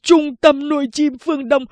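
high, loud furious curse; hét chửi giận dữ; angry cursing. Acted. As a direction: spit the name out in rage. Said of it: "vừa dẫm vừa chửi".

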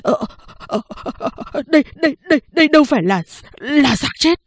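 frightened stammer, starting as a breath. Acted. Woman backing away stammering that the body is alive. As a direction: stutter it out in shock. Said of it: "miệng lắp bắp".

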